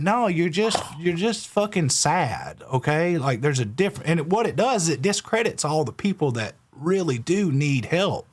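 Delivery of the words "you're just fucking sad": usual speaking voice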